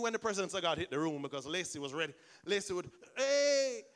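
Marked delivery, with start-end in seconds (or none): 3.2-3.8 loud, high whoop; joyful shout. Retold or imitated. Pastor imitating Lacey's whoop as she starts running, loud. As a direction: burst out with a joyful hey.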